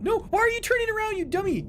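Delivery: very high frantic yelling at the ship; panicked shouting. Real, not acted. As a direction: scream orders in sheer panic. Ship turns the wrong way.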